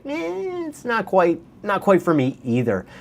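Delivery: high dismissive eh, then a shrugging brush-off; mock unimpressed buyer. Vlogger voicing buyers balking at the price, mock dismissive shrug. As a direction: play a buyer waving the house away. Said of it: "are probably gonna say".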